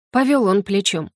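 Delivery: plain reading voice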